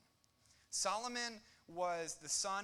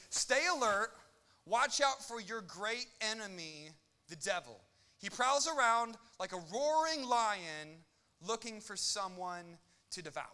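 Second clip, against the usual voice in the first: loud, slow Bible reading; scripture reading; 4 semitones higher, 7 dB louder, slower than usual. Acted. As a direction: proclaim the verse with weight.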